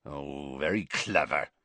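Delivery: low, soft growl. A narrator's reading. Hagrid to Harry after being outsmarted, growled. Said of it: "growled Hagrid".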